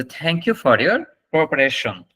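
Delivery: slow, deliberate teacher demo; slow model sentence. Shown as a demonstration. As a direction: model the full sentence slowly. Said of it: "সম্পূর্ণ সেন্টেন্সটা বলবেন".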